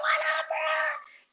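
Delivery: playful retold hello; mock casual greeting. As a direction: stretch out a high greeting.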